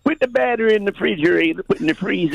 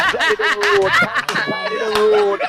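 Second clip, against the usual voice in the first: laughing high; 10 semitones higher than usual. Real, not acted. Mocking Ricky, laughing.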